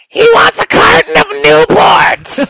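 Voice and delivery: high, loud, raspy puppet voice; raspy Elmo. Acted. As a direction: belt it like a smoke-ravaged kids' puppet. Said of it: "it sound like really raspy Elmo".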